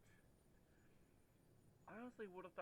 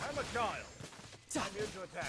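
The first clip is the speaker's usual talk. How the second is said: joking yell; 5 semitones higher, 13 dB louder than usual. Real, not acted. Player yelling during combat.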